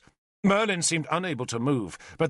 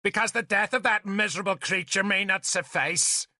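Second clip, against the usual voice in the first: raised, contemptuous tone; sneering; 6 semitones higher than usual. A narrator's reading. Nimue explaining the hostage to Arthur, sneering. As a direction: pour scorn on the victim. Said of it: "she said with a sneer".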